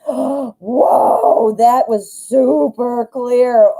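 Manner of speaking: gasping, drawn-out amazement; freaking out amazed. Real, not acted. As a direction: draw it out in amazement. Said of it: "I'm, like, freaking out".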